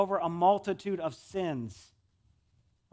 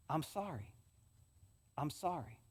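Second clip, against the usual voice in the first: soft apology; 2 semitones lower, 10 dB quieter than usual. Shown as a demonstration.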